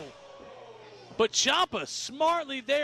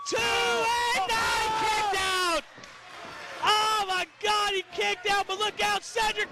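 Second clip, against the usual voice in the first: excited play-by-play shouting; wrestling commentator; 4 semitones higher than usual. Real, not acted. Finish of a wrestling match.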